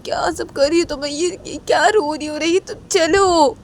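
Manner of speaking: a mother's sharp, rising scolding. Acted. Mother scolding her crying daughter to come inside, sharp and loud.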